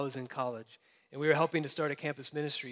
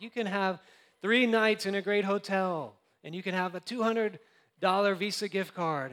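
lightly hammed-up timeshare pitch, higher; mock sales pitch; 5 semitones higher, 4 dB louder than usual. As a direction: hype the offer like a hotel promoter. Hotel's sales pitch voiced.

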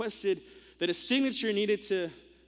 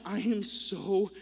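sincere apology; much slower than usual. Retold or imitated. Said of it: "I felt so terrible".

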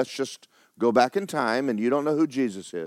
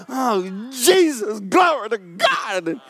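much higher, loud cry of glory; ecstatic praise; 11 semitones higher, 7 dB louder, much slower than usual. Retold or imitated. Worshipper overcome in church, ecstatic cry of praise. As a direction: shout it to heaven in rapture.

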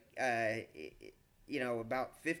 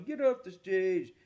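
imitating a heckler, louder and drawn out; mock heckle, stretched and raised over the room; heckler's call; 5 semitones higher, 7 dB louder, slower than usual. Heckler telling the comic to get off stage, mock drawn-out call. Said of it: "the guy's still going".

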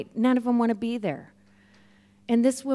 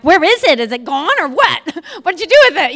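high, loud eager questions; pressing to see the gold dust; mock eager where-is-it; excited; 6 semitones higher, 9 dB louder than usual. Excited friend asking about gold dust.

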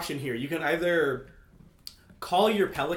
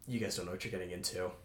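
low, quiet menacing threat; a soft, low voice with real menace; menacing warning; 9 semitones lower, 11 dB quieter, faster than usual. Retold or imitated. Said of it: "actual menace in his voice".

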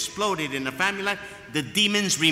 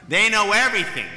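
everything said louder and higher, mocking; mocking teen know-it-all; 5 semitones higher, 6 dB louder than usual. Shown as a demonstration. Preacher mocks teenagers, louder and higher. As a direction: mimic the teens who think they know it all.

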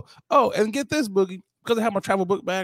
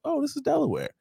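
mock realization; 11 semitones higher, 3 dB quieter, faster than usual. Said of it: "I was like".

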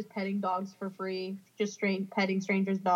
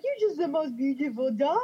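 higher-pitched gushing to a dog; doting ghost; 4 semitones higher, 4 dB louder than usual. Pretend. Playing a ghost admiring a dog, doting voice. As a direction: coo over the dog in a put-on voice. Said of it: "I'd be like".

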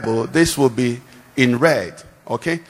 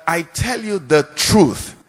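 "I tell you the truth" slow, leaning on tell; emphatic quote; 2 semitones higher, slower than usual. Shown as a demonstration. Preacher stressing Jesus' words to the congregation, slow and weighty. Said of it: "Means I'm emphasizing this particular thing".

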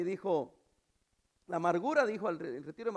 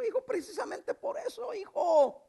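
high, building fatherly proclamation; impassioned preacher voicing the father; rejoicing father; 8 semitones higher, 8 dB louder than usual. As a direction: answer the son, swelling to a loud finish. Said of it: "Y le dijo".

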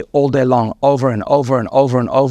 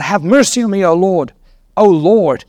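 mild, higher-pitched cry for mercy; 5 semitones higher than usual. A narrator's reading. Woman appeals to Jesus for her daughter, mildly crying out. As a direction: lift the voice in an acted appeal. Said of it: "she cried out".

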